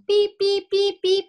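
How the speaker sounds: high, clipped beeping; imitated hospital beeps; monitor beeps. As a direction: mimic the heart monitor.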